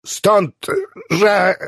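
high, halting start that breaks off; stumped mid-argument. A narrator's reading. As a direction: start insistently, pause, then get stuck. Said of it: "Clevengera zatkało i umilkł zbity z tropu".

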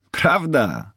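high, exclaimed question; calling out. A narrator's reading. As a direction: cry it out in amused surprise. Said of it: "zawołał". (zawołanie, wysokim głosem)

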